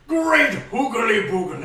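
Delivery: wacky character voice. Acted. Reading game text in wacky voice.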